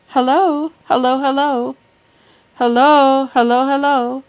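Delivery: testing the microphone out loud; loud mic test. Shown as a demonstration. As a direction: call hello into the mic to test it. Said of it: "Let me show you how it works".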